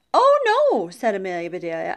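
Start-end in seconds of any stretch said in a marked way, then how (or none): 0.1-0.9 very high, loud "oh, no"; emphatic denial. A narrator's reading. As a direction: exclaim a surprised correction. Denies delivering cats.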